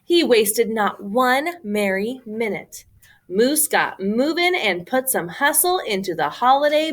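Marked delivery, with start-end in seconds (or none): none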